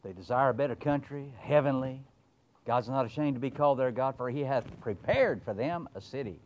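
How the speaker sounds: fast-paced pulpit reading; scripture reading. Acted. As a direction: proclaim the verse again. Rereads verse.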